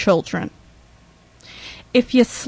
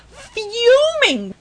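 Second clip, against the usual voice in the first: Fuming! burst out much higher; outraged exclamation; 9 semitones higher than usual. Real, not acted. Telling the podcast how angry she was, high outraged cry. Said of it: "I was fuming".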